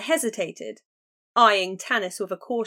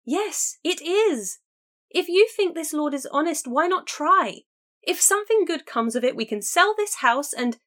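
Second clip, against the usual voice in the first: higher, louder eager insistence; insistent sister; 8 semitones higher, 3 dB louder than usual. A narrator's reading. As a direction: talk fast over her objections. Kiana insisting, pitch high.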